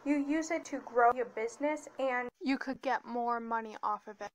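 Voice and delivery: ordinary delivery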